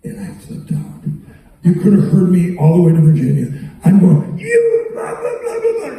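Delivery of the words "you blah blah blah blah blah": imitated screaming. Retelling his outburst in the Oval Office, mock screaming. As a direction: squeal out a mock rant. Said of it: "and I'm screaming".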